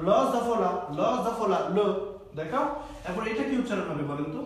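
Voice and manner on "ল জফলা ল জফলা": ল জফলা called out loud and clear, letter by letter; loud pronunciation drill. Shown as a demonstration. Teacher drilling French letters for online students, loud and clear. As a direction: spell out ল জফলা loudly for the class. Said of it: "এটা হবে ল।".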